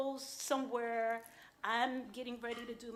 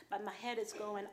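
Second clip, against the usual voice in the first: ordinary delivery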